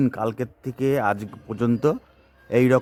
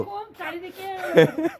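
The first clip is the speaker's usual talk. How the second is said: high laugh. Real, not acted. Laughs at the scene.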